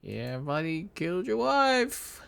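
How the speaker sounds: hammed-up leprechaun taunting the husband. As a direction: gloat over the killing. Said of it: "taunting him".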